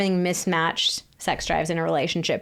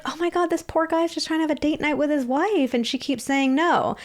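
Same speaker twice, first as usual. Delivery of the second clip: mock sympathetic gasp, pitched higher; empathetic onlooker; 8 semitones higher than usual. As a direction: play the shocked, pitying friend. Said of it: "we would be more empathetic to that".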